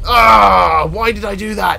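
loud groan of self-blame; frustrated cry. Real, not acted. Player groaning at his own mistake, frustrated cry. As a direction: kick yourself out loud.

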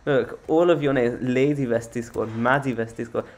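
normal talking voice

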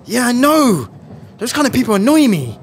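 fed-up outburst; exasperated. Acted. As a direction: vent loudly in agreement. Venting about people who ignore a robbery.

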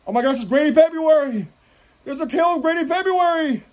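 high, squealed fake wonder; mock amazement. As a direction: announce a legend in a silly high voice. Mock amazement at Granny February.

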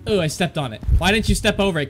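normal talking voice

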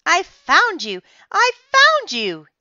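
high, loud yell, building on the repeat; excited yell. A narrator's reading. Claude spots the turkey and jumps, yelling. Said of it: "he yelled".

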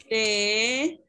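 "te" sounded out alone, drawn out; single syllable. Shown as a demonstration. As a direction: dictate "te" as a separate syllable. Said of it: "las vamos a dividir en sílabas".

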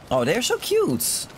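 ordinary talk, nothing marked

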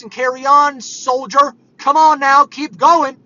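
hammed-up tough-love coach pushing you on; mock drill sergeant. Preacher mocking the world's tough-love, loud mock sergeant. Mocks the world's bootstrap pep talk.

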